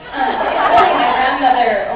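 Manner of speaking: usual speaking voice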